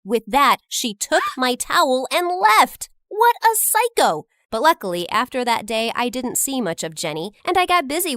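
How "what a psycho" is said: high, loud exasperated jab; indignant scoff. Acted. Narrator scoffs at Jenny walking off, high and loud. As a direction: fling it after her. After Jenny takes towel.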